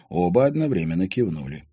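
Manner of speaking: plain reading voice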